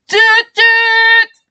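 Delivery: high, loud honking sound; horn honk. A narrator's reading. Driver honks the horn while leaving.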